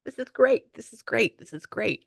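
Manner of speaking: channel someone thrilled, starting high and easing down; excited. Retold or imitated. Passing on an excited spirit's words, excited. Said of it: "they're very excited".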